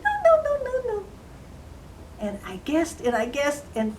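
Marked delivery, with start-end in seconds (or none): 0.0-1.0 very high squeaky voice; little man's refusals. Acted. Little man rejects queen's guesses, high squeaky voice. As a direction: rattle off tiny high-pitched refusals. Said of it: "he kept saying".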